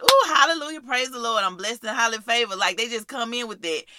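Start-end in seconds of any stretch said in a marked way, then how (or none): 0.0-2.6 mock gushing praise. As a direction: hit them with showy church talk. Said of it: "some people, uh, just come in with".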